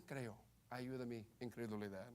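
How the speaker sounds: hushed, low reading without fervour; bare, understated reading. Shown as a demonstration. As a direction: drop the plea to a quiet, subdued quote. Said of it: "Dice solamente".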